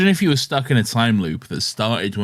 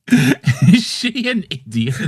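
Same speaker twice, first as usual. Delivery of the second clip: much higher, louder mock outrage; mock exasperated shout; 12 semitones higher, 3 dB louder, slower than usual. Friend calls out the co-host over a dumb character, high mock shout. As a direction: yell the name in hammed-up disbelief.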